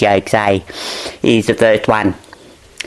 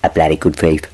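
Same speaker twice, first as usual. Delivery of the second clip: ordinary talk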